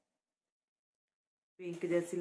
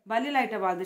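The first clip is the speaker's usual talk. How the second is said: calling out; 4 semitones higher, 7 dB louder than usual. Real, not acted. Calling out to someone nearby.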